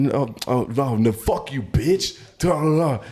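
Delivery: high-pitched imitation of a cursing customer; mock angry cursing. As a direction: curse someone out without real volume. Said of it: "cursing the manager".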